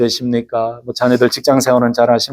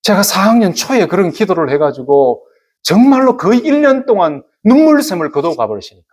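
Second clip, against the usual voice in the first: ordinary talk, nothing marked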